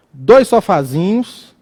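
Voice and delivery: normal talking voice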